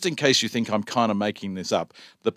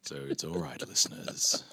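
low, soft late-night DJ; late night radio voice; 3 semitones lower, 6 dB quieter than usual. Pretend. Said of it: "my deep and sexy voice".

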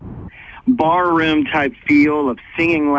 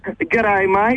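Aussie accent; 4 semitones higher than usual. Shown as a demonstration. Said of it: "Can, can you say, "G'day, mate"?".

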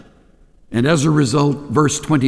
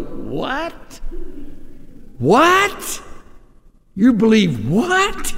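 high, rising disbelief, mocking; incredulous; 6 semitones higher, much slower than usual. Sophisticates hearing about the cross, in mock disbelief. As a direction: ask it as if they can't believe their ears. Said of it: "They'll go".

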